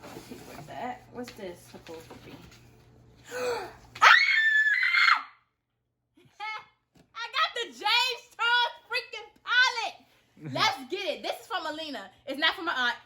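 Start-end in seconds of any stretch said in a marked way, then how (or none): 3.3-4.0 a sharp high gasp of delight; excited gasp. Real, not acted. Discovering the palette in the gift, a high gasp. 4.0-10.0 thrilled. Real, not acted. Announcing the dream palette to the camera, thrilled.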